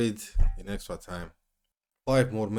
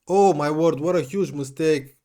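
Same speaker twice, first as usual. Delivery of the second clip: louder, higher dismayed outburst; shocked; 6 semitones higher, 8 dB louder than usual. Real, not acted. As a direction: react to a howler. Shocked at defensive error.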